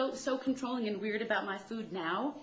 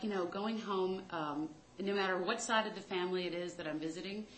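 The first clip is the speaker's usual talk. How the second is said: ordinary talk, nothing marked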